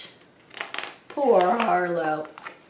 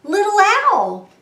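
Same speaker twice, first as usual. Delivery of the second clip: loud, high excited roar; Arlo; 10 semitones higher, 8 dB louder than usual. A narrator's reading. Lion calls to the owl, roaring. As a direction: call up to a friend at full voice. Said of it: "roared Arlo".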